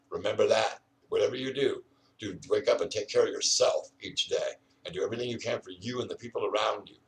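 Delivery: usual speaking voice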